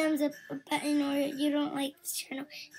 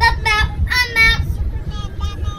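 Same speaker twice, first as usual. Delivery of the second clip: loud sign-off shout; 5 semitones higher, 13 dB louder, much slower than usual. Real, not acted. Girl shouting her sign-off into the camera.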